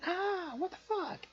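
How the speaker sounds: shrieking high in terror; panicked shriek at a sudden face; startled scream. Retold or imitated. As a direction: scream it as you fall backwards. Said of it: "he falls backwards screaming".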